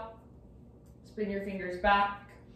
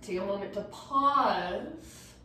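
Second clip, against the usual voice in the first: ordinary delivery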